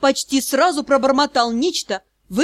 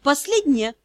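ordinary delivery

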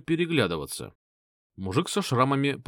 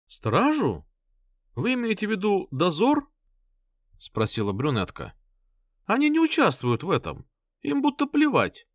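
lightly voiced scornful woman; 9 semitones higher than usual. Brunette answers, high.

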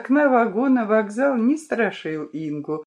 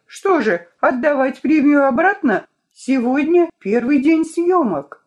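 higher, louder exasperated telling-off; indignant scolding; 7 semitones higher, 5 dB louder than usual. A narrator's reading. Vika scolds Inga for refusing to come, loud and exasperated. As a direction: pile on the complaints, voice rising.

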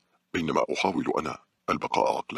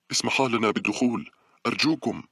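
higher-pitched plea called out to the guards; pleading call; 8 semitones higher, 3 dB louder than usual. A narrator's reading. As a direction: beg loudly to be let through. Calling to guards to be let in.

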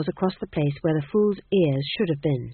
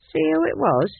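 much higher, curious guard; a second guard pitched up high; high-voiced guard; 10 semitones higher than usual. A narrator's reading.

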